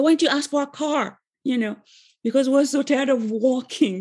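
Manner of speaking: put-on the teen outraged at a wasted wish; mad. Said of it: "And I was so mad".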